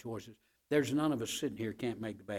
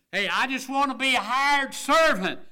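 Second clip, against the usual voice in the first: higher, loud cry of the returning son; pleading son; 13 semitones higher, 11 dB louder than usual. Acted. Prodigal son begging his father, high loud cry. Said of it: "the son saying".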